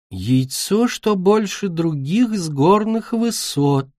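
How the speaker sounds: high, slow, dreamy recitation; absent-minded quoting. A narrator's reading. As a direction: recite it slowly, mind elsewhere. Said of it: "рассеянно процитировала ореола". (рассеянно цитируя, высоко и нараспев)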